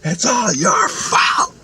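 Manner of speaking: high, slow, stretched-out scream; retold scream. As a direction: scream it right in his face. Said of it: "she screamed right in my face".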